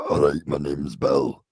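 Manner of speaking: a low, slow put-on man's voice, mocking the tape; deep slow ghost voice. Mimicking the ghost to his mates, a low slow mock voice. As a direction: drop the voice low and drag it out.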